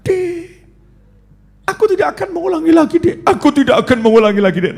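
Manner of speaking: pleading husband. Retold or imitated. Husband begging his rich wife for forgiveness, whiny. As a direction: grovel like a scared husband.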